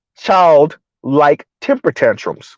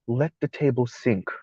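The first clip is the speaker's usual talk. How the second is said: hushed and much lower, demure mock; soft imitation; 7 semitones lower, 13 dB quieter than usual. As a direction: murmur it gently like the young lady. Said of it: "the young lady saying".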